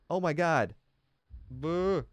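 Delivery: ordinary delivery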